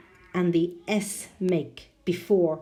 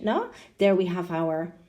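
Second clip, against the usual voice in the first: ordinary talk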